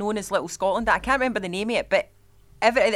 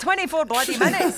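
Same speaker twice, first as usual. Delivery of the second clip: laughing; 8 semitones higher than usual. Real, not acted. Teasing about long story.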